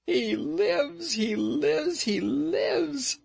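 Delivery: very high, rapturous chanting. A narrator's reading. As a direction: sing it out over and over in rapture. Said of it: "she repeated rapturously".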